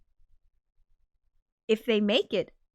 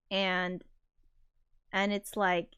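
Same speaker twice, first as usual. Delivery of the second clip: usual speaking voice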